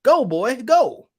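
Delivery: high, loud mock command; mocking impression. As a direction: mimic him urging them on, loudly. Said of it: "That's how he sounds to me".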